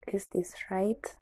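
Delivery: slow whisper to herself; whispered doubt. Retold or imitated. Inner doubt, alone.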